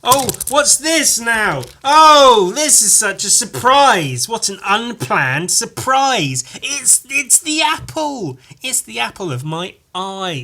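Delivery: high, slow, hammed-up astonishment; mock surprise. Podcaster putting on fake delight at the toy. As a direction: gush over it like a staged surprise.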